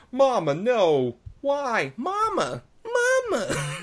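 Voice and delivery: hammed-up child crying for mama; Michael. Mocking the dubbed Michael, high babyish wail. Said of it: "Michael sounds like".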